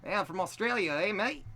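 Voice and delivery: high-pitched amateur Aussie accent; put-on Australian with questioning lift; attempted Australian accent. Shown as a demonstration. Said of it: "Yeah, that's not bad".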